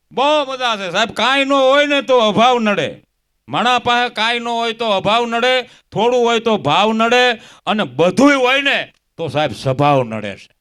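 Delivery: loud, high and slowed, driving the point home; stage sermon at full voice; preaching moral. Acted. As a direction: hammer each line to the crowd.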